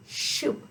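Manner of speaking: "shoop" with slushy sideways air for soup; lateral lisp. Shown as a demonstration. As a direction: let "shoop" leak out the sides. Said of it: "soup will sound like shoop".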